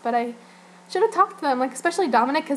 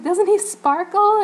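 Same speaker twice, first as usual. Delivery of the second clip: gushing excitement; 9 semitones higher, 5 dB louder, slower than usual. Retold or imitated. Gushing to MTV staff.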